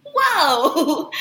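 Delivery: mild stunned "whoa" with a laugh; shocked. Retold or imitated. Reacting to being hung up on, laughing shock. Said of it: "I'm shocked, and I was like".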